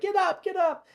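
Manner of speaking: high, loud cheering shout; crowd yell. Acted. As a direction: yell from the stands. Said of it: "you can hear people yelling".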